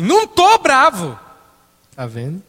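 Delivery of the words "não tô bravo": high, loud, slow 'not angry'; angry shouted denial. Retold or imitated. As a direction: blow up while swearing you are calm. Quarrel peaks.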